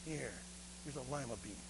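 low, very quiet surrender; grudging boy. Acted. Beggar boy handing the governor a lima bean, grudging. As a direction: give in softly and reluctantly.

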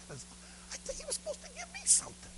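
tiny, very high hushed whine; whining boy. Acted. Beggar boy expecting treasure but losing food, whining. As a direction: squeak the complaint softly to yourself.

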